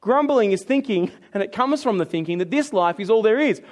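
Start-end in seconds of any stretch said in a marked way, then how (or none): none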